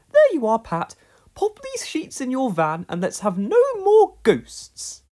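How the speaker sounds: loud, high flustered lady; breathless relief. A narrator's reading. Miss Hubbard, just freed, urges Pat on breathlessly. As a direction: catch your breath and laugh it off.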